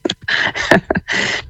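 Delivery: a real, airy unvoiced laugh; short embarrassed laugh; breathy laugh. Real, not acted. Guest laughs at the demand.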